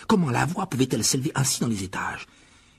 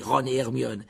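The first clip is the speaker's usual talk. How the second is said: plain reading voice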